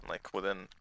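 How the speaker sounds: ordinary talk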